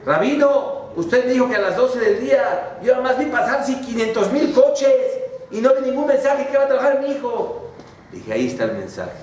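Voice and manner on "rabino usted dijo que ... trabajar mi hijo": high, indignant complaint; complaining father. Acted. Father complains to the rabbi, high indignant voice. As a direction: protest to the rabbi.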